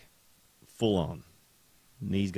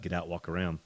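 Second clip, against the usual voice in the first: ordinary talk, nothing marked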